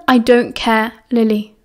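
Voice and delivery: ordinary delivery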